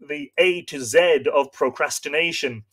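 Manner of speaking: ordinary talk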